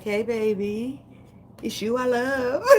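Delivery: slow, high, drawn-out flirty voice; hammed-up seduction breaking into a laugh; mock seductive. Woman voicing the figure on a tarot card, mock seductive. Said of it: "she looking all seductive and shit".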